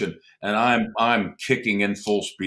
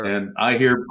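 usual speaking voice

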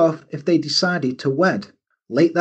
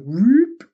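cartoon whoop; 8 semitones higher than usual. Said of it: "She just, like".

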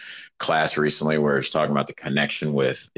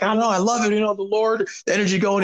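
much higher, bouncing with excitement; mock-excited high voice; hyped-up buddy; faster than usual. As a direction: imitate a pumped-up friend raving. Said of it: "He's like".

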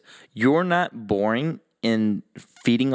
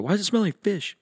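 higher, put-on puzzled voice; mock disgusted golfer; 9 semitones higher than usual. Golfer at the first hole complaining, mock disgust. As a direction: wrinkle your nose at the smell. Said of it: "First hole's like".

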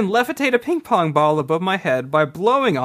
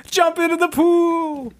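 high, slow cartoonish voice; hammy high voice; 12 semitones higher, slower than usual. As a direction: stretch it out in a silly high voice. Voicing Channing Tatum, high and slow.

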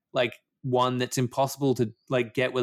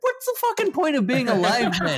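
high, loud disbelief through laughter; laughing outrage at a spoiler-loving friend; exasperated laughing outburst; 12 semitones higher, 4 dB louder than usual. Real, not acted. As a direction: laugh while yelling at a friend.

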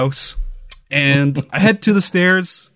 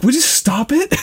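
exasperated wife; 11 semitones higher than usual. Retold or imitated. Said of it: "It's just like".

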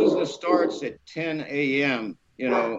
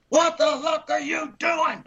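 very high-pitched outraged yell; yelling and cussing; 13 semitones higher than usual. Retold or imitated. Lady yelling from office at fence climber, outraged. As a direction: screech like you caught an intruder. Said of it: "She was yelling and cussing".

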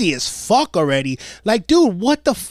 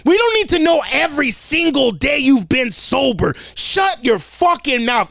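mock angry rant; 6 semitones higher than usual. Mock rant at sober friend.

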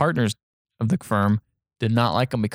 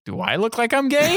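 much higher, hammed-up indignant question; high incredulous retort; 12 semitones higher than usual. Lawyer throwing the question back, high mock incredulity. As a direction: shoot the pitch right up in mock disbelief. Repeated, escalated retold question.

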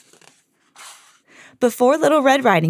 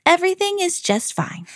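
put-on higher sweet, cheerful girl; 10 semitones higher than usual. A narrator's reading. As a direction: reassure sweetly. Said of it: "Little Red Riding Minnie replied".

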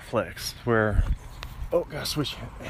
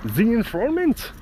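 German accent; 10 semitones higher than usual. Shown as a demonstration. Podcaster showing how the title must be said, German accent. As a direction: say the movie title like a German announcer. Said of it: "you have to say it in a German accent".

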